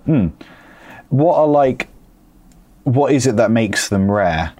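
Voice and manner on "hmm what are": normal talking voice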